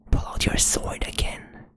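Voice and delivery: ordinary talk, nothing marked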